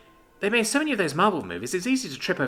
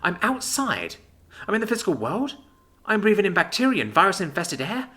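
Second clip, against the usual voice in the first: horrified; 6 semitones higher than usual. Acted. Libby horrified at outside.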